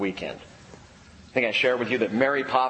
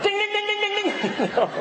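bell ding. Pretend. Delight meter going off.

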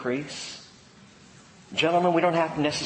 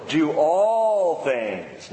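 "all" drawn out, very slow; preacher dwelling on "all", very slow; stress on all; much slower than usual. Shown as a demonstration. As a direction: hammer "all" word by word. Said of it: "literally translated all things".

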